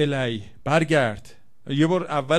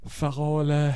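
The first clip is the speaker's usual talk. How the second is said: ordinary talk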